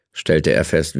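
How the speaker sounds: usual speaking voice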